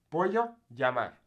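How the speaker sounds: "Pollo, llamar" with y for double L; Y sound. Shown as a demonstration. Teacher recaps double L, Spanish Y sound. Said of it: "The Y".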